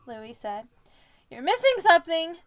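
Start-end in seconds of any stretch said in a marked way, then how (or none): 1.3-2.4 yell. A narrator's reading. Harry yelling in the story.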